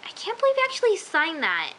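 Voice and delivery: high, incredulous outburst; exclaimed disbelief. Retold or imitated. Viewer reacting to the signed contract, incredulous.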